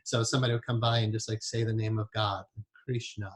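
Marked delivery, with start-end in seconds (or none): none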